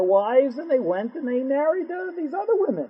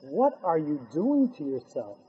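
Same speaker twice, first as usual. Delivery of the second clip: softer and lower, pained and almost hushed; soft pained question; 7 semitones lower, 7 dB quieter than usual. Acted. Hashem softly asks his people what they are doing. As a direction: ask it gently, heartbroken. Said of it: "Hashem says".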